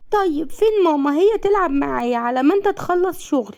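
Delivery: put-on high-pitched small child's voice; high, plaintive child voice; little child. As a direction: ask like a little kid missing mama. Narrator voices little boy Aser.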